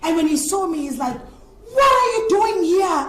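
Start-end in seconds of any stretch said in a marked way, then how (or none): 1.7-3.1 surprised. Retold or imitated. Friend shocked to see her.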